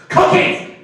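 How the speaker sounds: high, loud outburst; losing cool. Retold or imitated. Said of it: "I sort of lost my cool".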